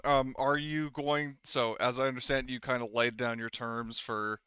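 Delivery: normal talking voice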